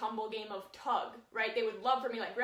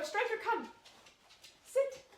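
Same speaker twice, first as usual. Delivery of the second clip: calling the dog; 10 semitones higher than usual. Real, not acted. Calling her dog to sit.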